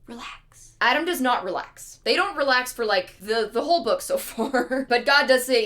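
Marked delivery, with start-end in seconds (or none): 0.0-0.8 whispered mock soothing; hushed calming. God calming a panicking Adam, in a whisper. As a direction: drop to a bare whisper to settle him.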